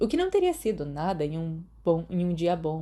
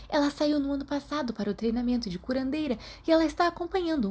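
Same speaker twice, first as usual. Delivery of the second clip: higher, chatty gossip voice, rushing on; a lightly voiced girl spreading rumours; gossipy schoolgirl; 6 semitones higher than usual. Mary gossiping to her friends in class, higher chatty voice.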